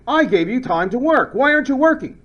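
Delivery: mock-exasperated teacher scolding a student who sits idle. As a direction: scold the class slacker.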